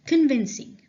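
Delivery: called out loud and high; loud announcement. Shown as a demonstration. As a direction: announce the word loudly to the class. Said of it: "Okay, convincing".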